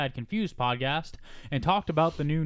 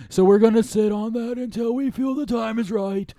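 higher, louder impersonation; put-on quote voice; 5 semitones higher, 5 dB louder than usual. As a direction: ham up the director's quote.

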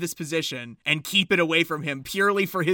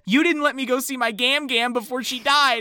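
whiny complaint; 8 semitones higher, 7 dB louder than usual. Retold or imitated. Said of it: "the best thing that Nick could come up with".

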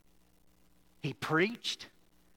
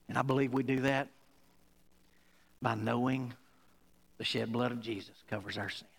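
lower, softer prayerful close; quiet closing prayer; 7 semitones lower, 4 dB quieter, faster than usual. Acted. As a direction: wind down gently into a prayer for the church.

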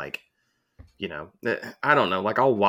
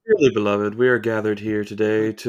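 officiant voice; 5 dB louder than usual. Pretend. Mock wedding officiant.

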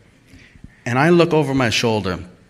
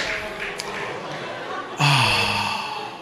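long, low exhausted sigh; soft sigh of regret; heavy sigh; 7 semitones lower, 10 dB quieter than usual. Real, not acted. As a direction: let the air out in defeat. After smashing wrong car.

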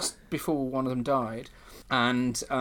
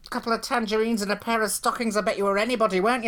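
louder, saucy mock scolding; cheeky teasing; 8 semitones higher, 6 dB louder than usual. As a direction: tease her like a bawdy panto dame. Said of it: "I say".

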